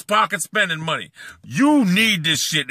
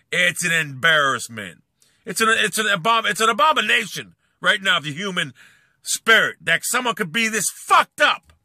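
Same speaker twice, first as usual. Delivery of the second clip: usual speaking voice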